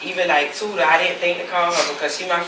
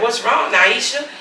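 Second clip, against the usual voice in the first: higher, louder, drawn-out anxious question; retold frantic plea; 7 semitones higher, 5 dB louder, slower than usual. Mother pressing her friend for news, retold as frantic pleading. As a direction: demand an answer, pitch and volume climbing. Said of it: "I was all over the place with my words".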